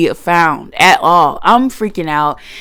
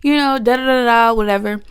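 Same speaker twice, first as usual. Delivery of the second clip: grandma voice; 7 semitones higher than usual. Retold or imitated. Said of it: "She's like".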